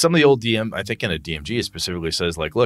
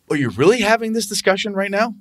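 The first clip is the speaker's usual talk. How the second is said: mock incredulous game master; 10 semitones higher than usual. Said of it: "Or like".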